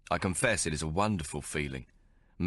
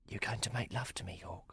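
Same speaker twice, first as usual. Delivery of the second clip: soft and quiet, much softer; 2 semitones higher, 10 dB quieter than usual. A narrator's reading. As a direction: ask it softly. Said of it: "asks softly".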